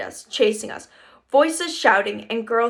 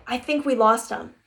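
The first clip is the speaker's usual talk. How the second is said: plain reading voice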